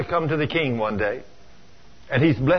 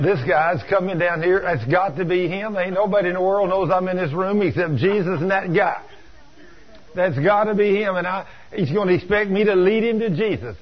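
raised pitch, urgent run of thoughts; flustered realisation; 4 semitones higher than usual. Retold or imitated. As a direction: panic mildly as it dawns on you. Realising the knock means him.

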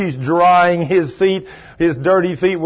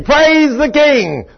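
much higher, loud, slow cry of praise; Dave's shout of praise; 12 semitones higher, 7 dB louder, much slower than usual. Retold or imitated. Healed Dave praising aloud, jubilant shout. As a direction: fling it upward with joy. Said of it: "It's Dave Rosenfeld".

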